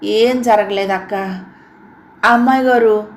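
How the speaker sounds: hesitant; stammering. A narrator's reading. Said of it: "అన్నాడు తడబడుతూ".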